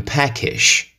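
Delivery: slow posh-British peckish; lightly British I'm peckish; British accent. Shown as a demonstration. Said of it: "In the British English, we say".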